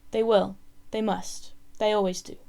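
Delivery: plain reading voice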